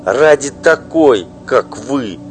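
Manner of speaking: loud, high, bitter tirade; furious wife. Acted. Wife denouncing her husband's mistress, furious loud outburst. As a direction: spit out the insults at her rival.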